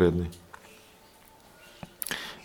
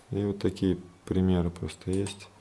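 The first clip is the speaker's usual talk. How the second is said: ordinary talk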